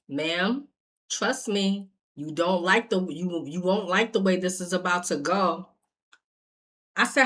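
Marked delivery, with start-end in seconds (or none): 0.1-5.7 low, soft official caution; hushed, controlled threat, retold; calm warning. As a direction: imitate an officer quietly warning someone.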